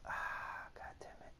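hushed disappointed muttering; muttered curse. Real, not acted. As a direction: curse under your breath. Can't afford upgrade.

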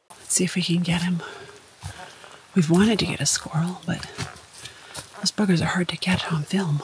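hushed whisper so the squirrel stays; soft whisper behind the camera. Real, not acted. Quietly filming a squirrel.

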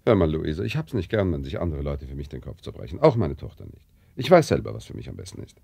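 angry scolding. Acted. Father scolding his daughter for meddling, loud and angry.

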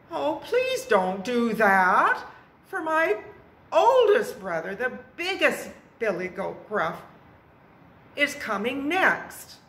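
high-pitched, insistent goat plea; pleading middle goat. A narrator's reading. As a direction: beg the troll to wait for your brother.

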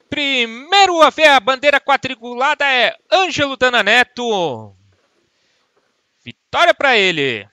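loud, high victory announcement; excited finish call. Real, not acted. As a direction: call the winner like a race commentator. Race winner called at finish.

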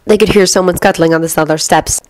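usual speaking voice

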